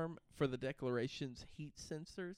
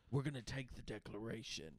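low, soft, lightly voiced movie schemer; film thief voice; 6 semitones lower, 11 dB quieter than usual. Retelling the film thieves' plan, lightly voiced low schemer. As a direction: declare the plan low and quiet.

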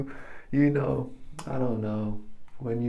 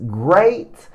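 ordinary talk

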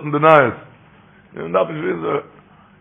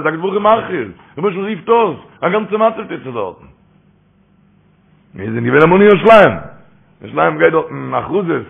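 ordinary talk, nothing marked

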